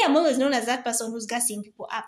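usual speaking voice

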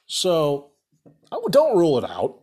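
ordinary talk, nothing marked

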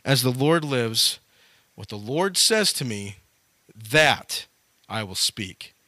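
slow, low solemn vow, softly weighted; quiet, measured prophetic resolve; powerful declaration. Acted. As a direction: declare it gravely, pausing between phrases. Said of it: "Micaiah says very powerfully".

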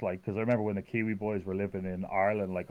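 normal talking voice

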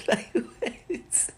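high, laughing what; laughing disbelief. Real, not acted. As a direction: laugh out the question.

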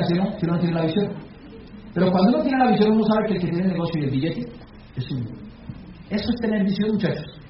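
ordinary delivery

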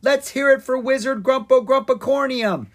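high, loud rallying yell. A narrator's reading. Dragon sister rousing townsfolk for the wizard, yelling. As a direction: whip up a crowd like a hype leader. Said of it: "Ella yelled".

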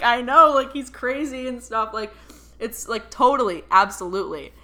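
high, loud gushing; retold eager agreement. Client agreeing dad is overwhelming, excited. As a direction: agree emphatically, bursting with it.

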